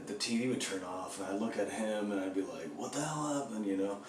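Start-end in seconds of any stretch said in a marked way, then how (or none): 2.8-3.7 higher-pitched baffled protest; mock bewilderment. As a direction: question the prankster in mock disbelief. Confronting roommate over TV prank.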